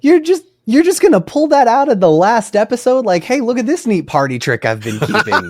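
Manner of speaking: high-pitched impressed disbelief; astonished teasing. Real, not acted. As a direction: tease them in amazed, rising excitement. Astonished teasing at surprise recitation.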